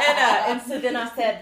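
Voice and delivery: high, loud, laughing. Real, not acted. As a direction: talk while still laughing. Laughing after the joke.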